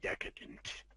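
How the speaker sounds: savoring whisper of Decadent. Real, not acted. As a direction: breathe Decadent out with relish. Whispered relish of word.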